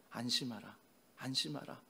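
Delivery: soft, hushed comfort; tender reassurance. Acted. The Lord's first words to struggling disciples, tender reassurance. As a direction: say it low and quietly to soothe.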